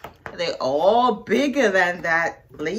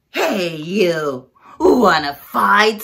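louder grouchy challenge; grouchy ladybug voice; 8 dB louder, much slower than usual. Acted. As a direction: pick a fight, gruff and loud.

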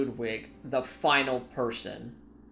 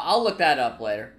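normal talking voice

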